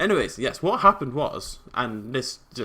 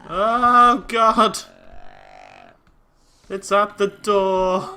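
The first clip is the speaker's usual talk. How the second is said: high, loud panicked cry; panicked scream; 8 semitones higher, 10 dB louder, much slower than usual. Real, not acted. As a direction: scream in terror. Creeper at the door.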